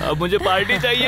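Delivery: high, excited celebration; jubilant demand. Acted. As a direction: claim a treat amid the cheering. Friends celebrate romantic success.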